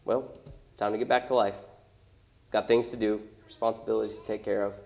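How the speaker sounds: low, soft, deflated muttering; subdued, low voice of someone giving up; resigned. Acted. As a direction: give up quietly and turn back to chores. Voicing people quietly giving up on prayer.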